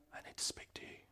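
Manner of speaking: near-whisper, barely voiced; hushed retold words. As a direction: voice her very quietly, almost under the breath. Quotes her tense famous words.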